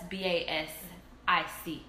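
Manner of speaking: B A S I C said letter by letter; B-A-S-I-C, each letter named separately; spelling out BASIC. Real, not acted. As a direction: spell out B A S I C one letter at a time. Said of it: "We're spelling".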